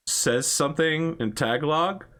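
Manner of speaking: ordinary delivery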